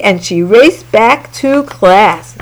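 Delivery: ordinary delivery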